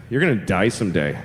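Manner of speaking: low, matter-of-fact threat; deadpan menace. Retold or imitated. Seven-year-old to the bragging neighbor kid, deadpan menace. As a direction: deliver doom in a low deadpan. Kid coldly tells rival he'll die.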